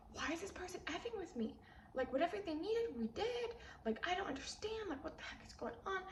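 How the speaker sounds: high-pitched, incredulous venting; a high, baffled why-me complaint; exasperated rant. Retold or imitated. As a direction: pitch it way up in disbelief. Venting about person messing with her.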